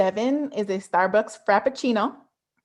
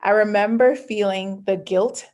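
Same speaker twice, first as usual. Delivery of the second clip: normal talking voice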